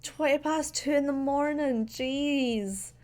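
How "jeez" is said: normal talking voice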